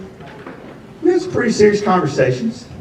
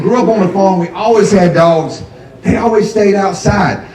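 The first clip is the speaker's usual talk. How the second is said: ordinary delivery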